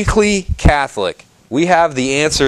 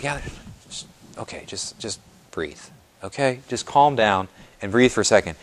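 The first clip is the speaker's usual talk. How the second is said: soothing whisper; 3 semitones lower, 7 dB quieter than usual. Pretend. Church soothing a struggling person, near whisper. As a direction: hush them gently so they can breathe. Voicing the church calming someone.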